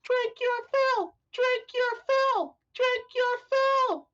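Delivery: very high, slow, loud chant; drawn-out high-pitched tavern cheer; loud crowd repetition. Acted. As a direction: chant it like a roomful of drinkers. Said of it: "loud repetition from the tavern goers".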